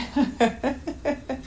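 soft, rippling chuckle; quiet run of amused giggles; mother's laugh. A narrator's reading. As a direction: laugh fondly at a child. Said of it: "Mommy laughed again".

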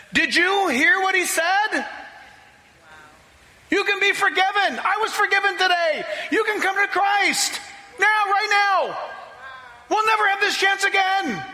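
high, loud, jubilant cry to the room; excited shout. Retold or imitated. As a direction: shout the good news to the whole family. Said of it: "Kaha stood up and said".

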